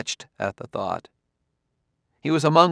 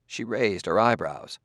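ordinary delivery

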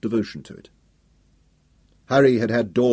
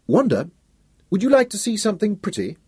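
sudden energy; 10 semitones higher than usual. Acted. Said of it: "he said with sudden energy".

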